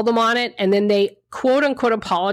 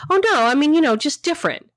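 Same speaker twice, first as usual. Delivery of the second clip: higher, dodging mock voice of the date; evasive date; 6 semitones higher than usual. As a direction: backpedal like a date caught out. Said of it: "the guy said".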